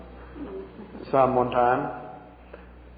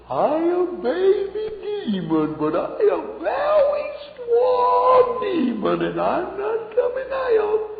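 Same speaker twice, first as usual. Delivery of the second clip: put-on squeaky, much higher, slow, childish demon voice; baby demon voice; much slower than usual. Demon defying the praying pastor, high slow squeak. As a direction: pitch way up and drag each word like a cocky little demon. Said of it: "that's the way he talked".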